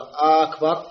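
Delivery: آه أكبر with the hamza dragged out long; wrong long hamza on أكبر; drawn-out hamza. Shown as a demonstration. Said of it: "ولا تمد همزة أكبر".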